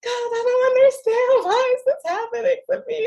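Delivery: high, half-crying retold lament; despairing plea to God. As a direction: cry out to God, overwhelmed and at a loss. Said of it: "I'm just like".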